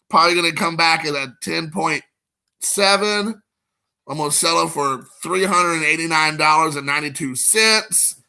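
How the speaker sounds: usual speaking voice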